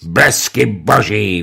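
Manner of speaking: loud, slow oath; angry shout; furious outcry. Acted. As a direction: roar the curse in rage. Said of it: "vykřikl".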